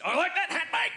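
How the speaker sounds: mock Australian. Comedian repeating his Australian line for the applauding crowd. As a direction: toss it off like a cheeky Aussie. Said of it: "Don't move your lips or your tongue".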